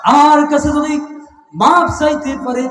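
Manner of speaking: high, loud proclamation; full-force preaching. Acted. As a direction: ring it out to the crowd.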